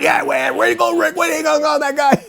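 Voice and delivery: high-pitched mock shouting; imitated heckling callers. Angry radio listeners heckling the host, imitated high shouting.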